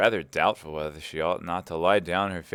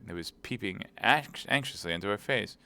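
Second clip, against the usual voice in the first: plain reading voice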